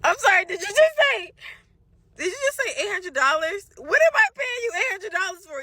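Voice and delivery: outraged disbelief. Retold or imitated. Caller hearing a surprise eight hundred fee, outraged. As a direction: hit it like you just got robbed.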